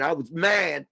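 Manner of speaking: ordinary talk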